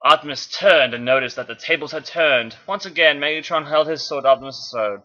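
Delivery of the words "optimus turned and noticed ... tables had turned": ordinary delivery, nothing marked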